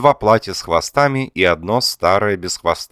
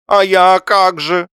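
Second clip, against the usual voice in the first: high, loud, drawn-out wail; theatrical high-pitched hurt; plaintive plea; 13 semitones higher, 7 dB louder, slower than usual. A narrator's reading. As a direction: whine it as a forsaken husband. Abandoned husband pleads with wife.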